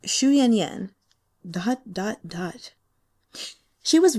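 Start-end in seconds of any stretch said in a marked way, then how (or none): none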